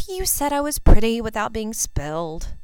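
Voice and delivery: plain reading voice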